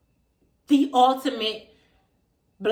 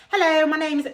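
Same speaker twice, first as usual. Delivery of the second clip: higher, put-on British greeting; mock London accent; 6 semitones higher, 4 dB louder, faster than usual. A chick introducing herself on camera, mock London accent. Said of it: "talking with a Londish accent".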